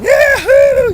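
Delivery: high whooping cheer; whooping yell. Retold or imitated. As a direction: whoop it out like a rowdy guest. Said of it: "hooting and hollering".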